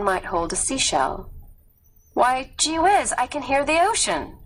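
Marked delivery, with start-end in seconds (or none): 2.1-4.4 high-pitched playful quip; joking. A narrator's reading. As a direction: toss it off lightly, voice lifted high. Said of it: "he jokes".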